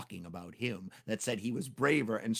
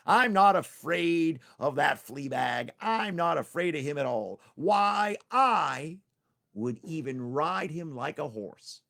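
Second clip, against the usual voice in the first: boastful; 4 semitones higher, 7 dB louder, slower than usual. Acted. Tricky boasts.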